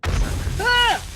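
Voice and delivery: loud, high startled yell; sudden "Ah!" at full voice; startled scream. Real, not acted. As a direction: cry out at a sudden mishap. Sudden mishap in the game.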